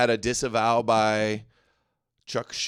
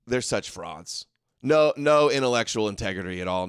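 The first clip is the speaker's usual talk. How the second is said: ordinary talk, nothing marked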